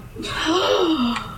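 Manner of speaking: high dramatic gasp; long, theatrical intake of breath; mock gasp. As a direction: gasp for effect at a big entrance. Dramatic entrance of new girl.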